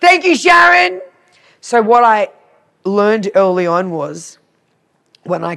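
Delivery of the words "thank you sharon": loud, very high, sing-out gratitude; called-out thanks. Pretend. Calling thanks to Sharon for the grout lesson, exuberant. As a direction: holler it to someone far away.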